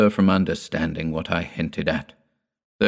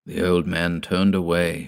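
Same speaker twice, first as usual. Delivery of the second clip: ordinary delivery, nothing marked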